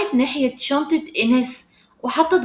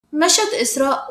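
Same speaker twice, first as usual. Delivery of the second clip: ordinary delivery, nothing marked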